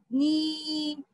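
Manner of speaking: नी with a drawn-out ee; long ee sound. Shown as a demonstration. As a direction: hold the vowel of नी steadily. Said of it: "आप ऐसे उच्चारण करोगे". (नी को लंबी ई से खींचकर)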